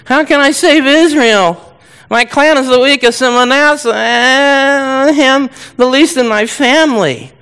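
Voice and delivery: exaggerated high, drawn-out whine; whining. Gideon protesting to God that he can't save Israel, whining. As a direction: whine it out slowly, pitched high. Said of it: "you can hear the whining in his voice".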